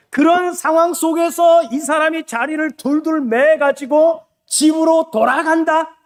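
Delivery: ordinary talk, nothing marked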